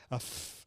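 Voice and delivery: long breathy f hiss; unvoiced f sound. Shown as a demonstration. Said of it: "breathe out to these different sounds".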